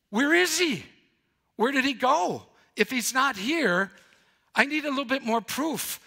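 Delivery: high, doubtful asking; incredulous questioning. Retold or imitated. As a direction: demand proof, pushing back. Voicing doubtful disciples questioning.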